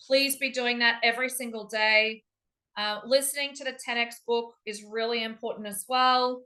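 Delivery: ordinary talk, nothing marked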